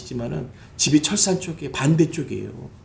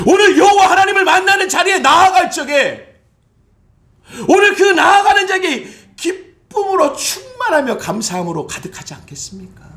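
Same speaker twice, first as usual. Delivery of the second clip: fiery preaching; 13 semitones higher, 5 dB louder than usual. Acted. Pastor calling the church to joy, fiery preaching. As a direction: sound near tears with joy.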